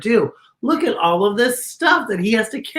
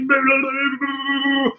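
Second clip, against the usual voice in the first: shivering monster; 3 semitones higher, 3 dB louder, much slower than usual. Acted. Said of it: "He's like".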